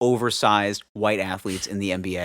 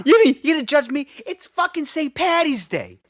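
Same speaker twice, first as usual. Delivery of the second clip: put-on indignant drunk; 14 semitones higher than usual.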